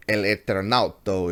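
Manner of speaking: bad Spanish accent. Shown as a demonstration. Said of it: "I'm sorry for my terrible, uh, terrible Spanish accent".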